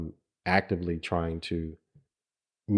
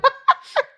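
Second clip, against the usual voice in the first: big burst of embarrassed laughter; loud laugh; 11 dB louder than usual. Real, not acted. Husband laughing after forgetting her question.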